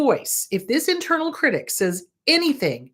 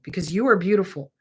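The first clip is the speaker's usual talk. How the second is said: normal talking voice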